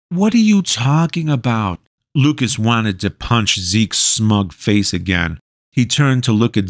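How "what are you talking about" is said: put-on confused teen voice. Lucas confused.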